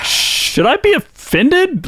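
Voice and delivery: high, slow, wide-eyed innocence; feigned naive question; mock innocent high voice. Innocent quote from a player.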